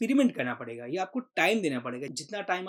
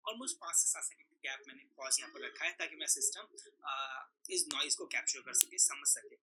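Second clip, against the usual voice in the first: the same recording played back softer, background noise removed; noise-cleaned playback; 10 dB quieter than usual. Shown as a demonstration. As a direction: replay it cleaned, the hiss gone. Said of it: "यहाँ पर almost clean हो गई है". (Noise हटाकर साफ़ की गई धीमी रिकॉर्डिंग)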